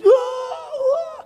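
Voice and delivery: very high mock wailing; imitating child crying. Hurt child crying to his father, high mock wail. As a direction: wail like a small hurt kid.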